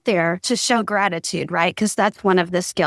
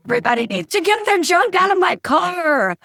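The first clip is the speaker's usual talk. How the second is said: mock motivational rant; 10 semitones higher than usual. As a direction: bark orders at everyone to clear the junk. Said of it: "what I thought was a motivational speech".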